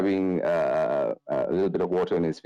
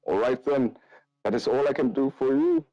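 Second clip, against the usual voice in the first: raised-pitch priest voice; priest character; 8 semitones higher than usual. Acted. Priest tells party the blessing is done, raised priest voice. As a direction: play an obliging priest, voice lifted high.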